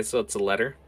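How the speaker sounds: ordinary delivery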